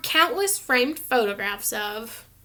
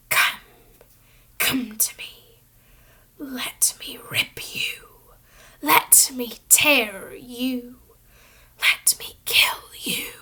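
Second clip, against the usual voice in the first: slow venomous whisper from nowhere; chilling whisper; slower than usual. A narrator's reading. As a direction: breathe it out like a hungry monster. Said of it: "A voice to chill the bone marrow".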